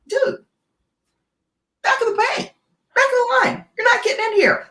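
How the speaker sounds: high, brushing-off voice; mock dismissive. Customers telling robber to wait, brushing off. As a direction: shoo him to the back of the line.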